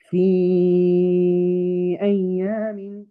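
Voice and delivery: slow recitation, the ي of في held long before أيام; mad munfasil recitation. Shown as a demonstration. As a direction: stretch في slowly into أيام.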